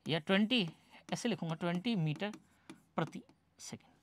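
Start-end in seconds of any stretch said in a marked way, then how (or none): 3.0-3.9 whispered unit. Shown as a demonstration. Teacher reads the unit.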